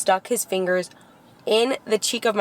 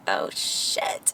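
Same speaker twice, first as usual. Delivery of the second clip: hushed, breathy "Oh shit" under the breath; whispered curse; 12 semitones lower, 5 dB quieter than usual. Retold or imitated. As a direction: whisper it like you're bracing for pain. Reacting to the chiropractor's gloves.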